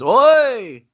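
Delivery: high, loud, drawn-out "oi"; fight-crowd yell. Retold or imitated. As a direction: yell it like a ringside cheer. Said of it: "that's what everybody's yelling".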